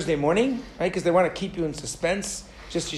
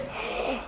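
excited gasp; 11 dB quieter than usual. Retold or imitated. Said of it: "I'm like".

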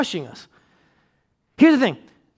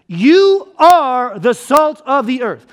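slow, weighty, each word laid down; quoting Jesus with slow weight; solemn slow quote; 4 dB louder, much slower than usual. Shown as a demonstration. Preacher quoting Jesus slowly and deliberately.

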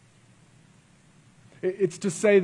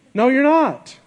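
higher and louder, punching "No, you're not."; emphatic rebuttal; 7 semitones higher, 6 dB louder than usual. Shown as a demonstration. Preacher rebutting the lyric to congregation, emphatic and louder. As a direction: hit "No, you're not." up high and strong.

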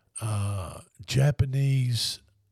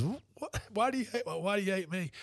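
hurt surprise; 7 semitones higher, 9 dB quieter than usual. Retold or imitated. Favre asks, hurt and surprised.

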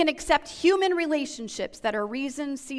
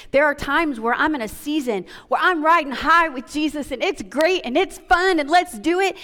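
louder and higher, driving each line home; full-force preaching; 3 semitones higher, 5 dB louder than usual. Real, not acted. Pastor preaching to the congregation about the same God in valleys, full-force and loud. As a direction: preach it with rising fire.

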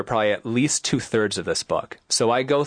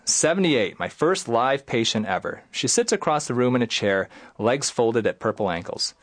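normal talking voice

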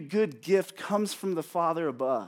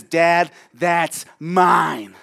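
put-on much louder, very slow kid's claim; possessive toddler; 10 dB louder, much slower than usual. Little daughter snatching her toy back from dad, loud and slow. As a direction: drag it out like a stubborn child grabbing a toy. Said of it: "and says".

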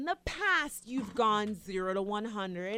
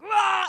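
mock growl; 10 semitones higher, 13 dB louder than usual. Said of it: "you're like".

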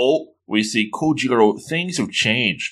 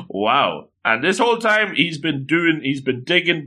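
usual speaking voice

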